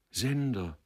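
high, anguished call; understated tearful cry; sobbing plea. A narrator's reading. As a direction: call out to him imploringly. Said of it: "schluchzte sie".

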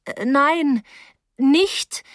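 high, hesitant protest; anxious pleading. A narrator's reading. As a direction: beg them nervously to stop. Girl begs Rose to stop.

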